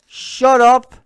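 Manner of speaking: real outburst at the screen; furious shout. Real, not acted. Streamer yelling at a politician on screen, furious shout.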